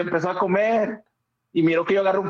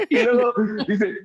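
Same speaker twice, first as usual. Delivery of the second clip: normal talking voice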